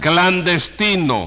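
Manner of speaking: "Clandestino" drawn out slowly, high; emphatic correction. Acted. Judge corrects the mispronounced word.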